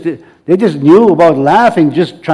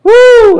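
high, loud joyful whoop; joyful woo shout; 7 dB louder than usual. Real, not acted. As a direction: shout out for joy. Praise break shout.

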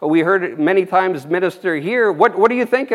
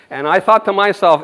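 normal talking voice

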